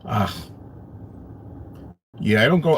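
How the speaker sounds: ordinary talk, nothing marked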